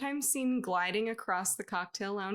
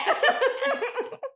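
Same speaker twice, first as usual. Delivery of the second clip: high laugh; 7 dB louder than usual. Real, not acted. Laughing hard.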